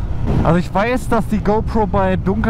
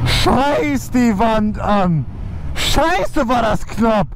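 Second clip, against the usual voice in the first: high, shocked swearing after a scare; adrenaline shakes; 5 semitones higher, much slower than usual. Real, not acted. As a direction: still reeling from the close call. Close call just happened.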